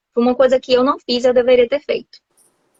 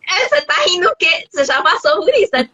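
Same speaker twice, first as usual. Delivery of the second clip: teasing locals; 7 semitones higher than usual. Retold or imitated.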